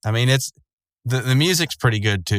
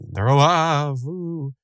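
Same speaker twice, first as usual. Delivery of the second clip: mock spooky; 3 semitones higher, much slower than usual. Mock-spooky reveal of living holograms.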